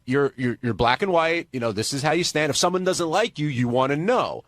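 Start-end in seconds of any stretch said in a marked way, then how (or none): none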